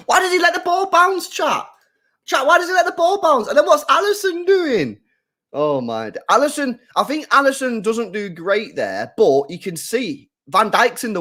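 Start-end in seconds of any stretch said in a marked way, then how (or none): 0.1-5.0 fast, high, loud questions to chat; incredulous rant. Real, not acted. Streamer asking chat about the blunder, incredulous rant. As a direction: rattle it off in disbelief.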